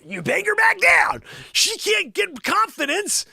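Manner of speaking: high, loud hammed-up rant; mock tirade. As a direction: shout her down in a high mock fury. Mock tirade putting Joanne down.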